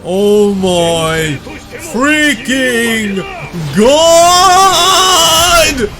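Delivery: real shocked yell of disbelief; excited shout. Real, not acted. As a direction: yell it out as the scene hits. Reacting to a shocking scene.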